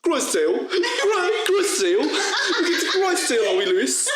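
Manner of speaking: high, laughing mock London accent; mocking the tutor. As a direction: tease the teacher in a silly high voice.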